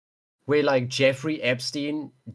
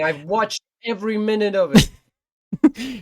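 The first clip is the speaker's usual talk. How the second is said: mock Trump voice; 7 semitones higher, 6 dB louder than usual.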